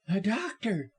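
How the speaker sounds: high, breathless cry for help; gasped. A narrator's reading. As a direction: gasp it out in alarm. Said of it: "she gasped".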